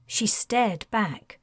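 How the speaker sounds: plain reading voice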